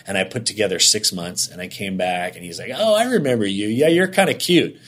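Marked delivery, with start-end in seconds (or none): 2.8-4.8 a light, higher flirty tease; lightly voiced boss, playful and flirting. Boss flirting on return visit.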